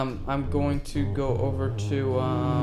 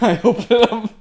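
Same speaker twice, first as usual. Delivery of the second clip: louder words pushed out through a laugh; laughing while talking; 9 semitones higher, 11 dB louder than usual. Real, not acted. Player describing the elf's trick, words broken by laughter. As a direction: giggle through the line.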